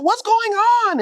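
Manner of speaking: a woman jolted awake asking what's happening; Dime's startled question. Retold or imitated. Dime woken by the screaming, high alarmed question. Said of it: "Dime like".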